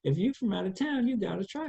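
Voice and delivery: a hint of a sassy New Orleans lady; nosy stranger. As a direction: playfully call out the stranger.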